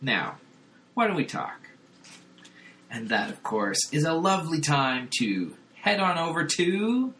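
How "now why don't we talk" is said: robot body voice. Retold or imitated. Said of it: "And the body's like".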